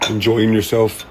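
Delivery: ordinary talk, nothing marked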